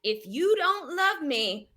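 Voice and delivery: very slow, drawn-out demand in a put-on rural accent. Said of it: "this accent's really coming to me".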